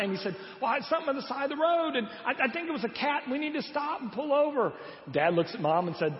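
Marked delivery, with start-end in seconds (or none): 0.6-4.7 put-on high, fast, breathless kid talking over himself; excited child. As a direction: rush it out like an anxious eight-year-old. Child explains, pleads with dad.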